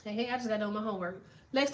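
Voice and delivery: ordinary talk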